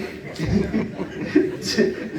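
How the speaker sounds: quiet laugh to oneself; soft chuckle. Real, not acted. Poet joking about beards with young listeners, chuckling. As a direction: chuckle softly at your own joke.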